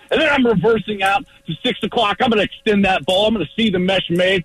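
high, pumped-up play call; live play-by-play. Acted. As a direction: narrate the play like you're in it. Acted play-by-play of a run play.

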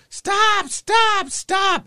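high-pitched urgent cry for help; imitated panicked shouting; frantic yelling. Acts lady flagging him down.

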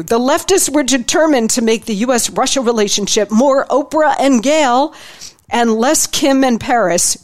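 slightly lifted book-reading voice, lightly dramatized; reading from his book. Acted. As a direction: read the passage to listeners with mild flair. Reads aloud from his book.

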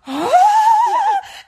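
sharp, very high, loud gasp of terror; horrified gasp. Retold or imitated. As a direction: imitate a toddler's frightened gasp. Daughter terrified in ant-covered seat.

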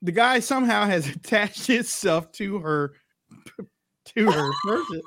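fighting back laughter; trying not to crack up; stifled giggles. Real, not acted. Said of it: "And I'm trying not to laugh though".

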